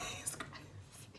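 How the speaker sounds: slow, hushed whisper; drawn-out voiceless whisper; dramatic whisper. Acted. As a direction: whisper it as a stunned aside. Same words whispered, then squealed.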